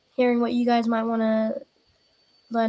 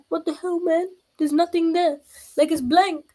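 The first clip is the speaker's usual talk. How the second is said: higher, puzzled complaint; mock confusion; 6 semitones higher than usual. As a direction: act baffled at a blank sheet. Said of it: "it's gonna be me going".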